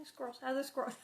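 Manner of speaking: usual speaking voice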